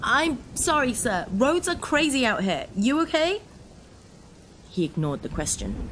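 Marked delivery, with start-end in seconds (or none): 0.0-3.4 raised, higher voice over the storm; shouting over rain. Acted. Driver shouting over the rain to the officer. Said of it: "I shouted over the rain".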